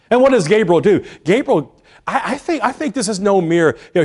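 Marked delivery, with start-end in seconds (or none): none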